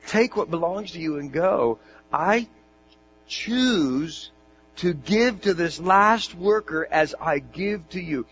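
firm master. Acted. Master answers the grumblers.

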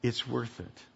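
softly voiced, understated quote; quiet encouragement. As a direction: say it softly, as earnest reassurance. Voices Paul encouraging believers.

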